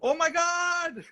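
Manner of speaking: mock panicked high squeal; frantic Lisa. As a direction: read the message like a frantic director. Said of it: "it was like".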